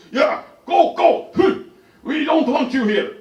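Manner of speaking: high, loud dismissive yelling; hammed-up furious old man; old man screaming. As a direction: shout them out of the room.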